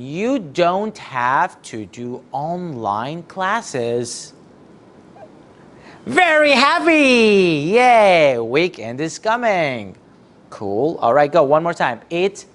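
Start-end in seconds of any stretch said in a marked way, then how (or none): 6.1-8.4 high, louder cheer; excited cheer. Acted. Teacher to pupils, cheering for the weekend. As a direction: celebrate out loud for the kids.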